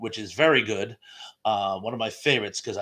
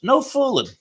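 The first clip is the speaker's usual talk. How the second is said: playful surprise; 13 semitones higher, 6 dB louder than usual. Real, not acted. April first birthday joke.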